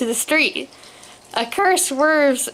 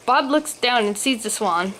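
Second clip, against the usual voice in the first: usual speaking voice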